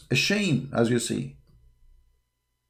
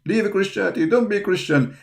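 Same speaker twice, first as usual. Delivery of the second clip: high-pitched mimicry of a jeering opponent; pitched-up mocking taunt; mock taunting critic; 9 semitones higher than usual. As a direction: parrot their taunt, pitched up.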